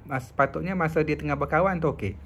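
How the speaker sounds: ordinary talk, nothing marked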